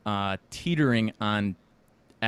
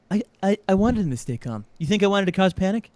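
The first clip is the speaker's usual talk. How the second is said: higher, louder imitation of Trump protesting; Trump impression; 8 semitones higher, 6 dB louder than usual. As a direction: do Trump getting defensive, raised and loud. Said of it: "And he's like".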